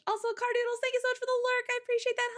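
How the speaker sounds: bright high-pitched thanks; high cheery voice. Real, not acted.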